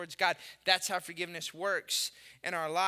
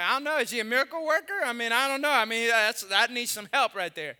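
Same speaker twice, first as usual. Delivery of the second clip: doubtful joking; 3 semitones higher, 6 dB louder than usual. Retold or imitated. His doubtful reply about the son.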